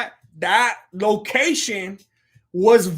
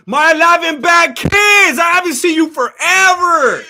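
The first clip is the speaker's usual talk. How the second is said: higher and louder, slow happy shout; excited shout greeting; 7 semitones higher, 10 dB louder, slower than usual. Real, not acted. Streamer greeting a long-missing viewer, loud excited shout. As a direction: welcome them back at full volume.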